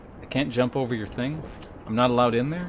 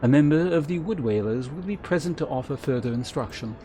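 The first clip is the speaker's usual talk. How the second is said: measured, ceremonious quest-giver voice; stately guild elder; 5 semitones higher than usual. Acted. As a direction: instruct a new adventurer like a gracious mentor.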